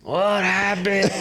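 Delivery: slow, high mom voice; a mother drawing it out suspiciously; mock concerned mom; mom asking. Mom voiced checking on child.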